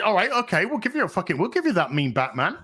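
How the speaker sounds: usual speaking voice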